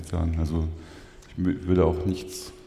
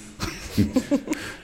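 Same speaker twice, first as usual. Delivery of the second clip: hohes, gehauchtes Lachen. Real, not acted. Lacht über eigene Fehlprognose.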